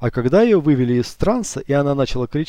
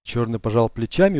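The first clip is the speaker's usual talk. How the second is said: ordinary delivery, nothing marked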